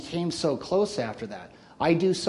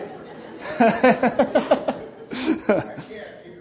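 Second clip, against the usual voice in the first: breathy laugh; 9 semitones higher than usual. Real, not acted. Laughs after remark to Rex.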